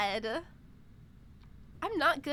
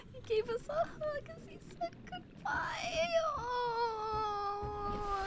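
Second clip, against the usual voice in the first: very high squeaky tearful voice; emotional, crying; 10 semitones higher, much slower than usual. Real, not acted. Streamer moved by pet's goodbye hug, crying. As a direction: let the voice climb into a wail. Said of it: "I'm getting emotional".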